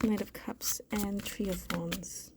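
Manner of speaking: slow hushed whisper of the card names. Shown as a demonstration. Tarot reader whispering the drawn cards to herself, hushed. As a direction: breathe the card names out, barely voiced.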